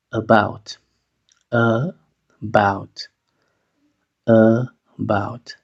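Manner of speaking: "About" with a weak schwa start, uh-BOUT; initial schwa. Shown as a demonstration. Teacher drilling the word, weak initial schwa. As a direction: begin "About" with a short neutral uh. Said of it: "La A va a sonar schwa".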